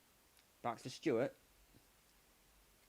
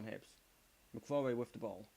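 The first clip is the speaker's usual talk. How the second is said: normal talking voice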